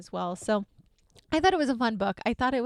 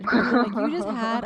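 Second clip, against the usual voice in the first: laughing; 7 dB louder than usual. Real, not acted. Laughing while praising co-host.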